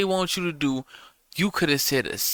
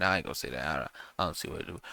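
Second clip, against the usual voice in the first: much softer, quick, under the breath; hushed self-correction; muttered aside; 10 semitones lower, 10 dB quieter, much faster than usual. Real, not acted. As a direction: swallow the remark as you take it back. Catches himself, takes it back.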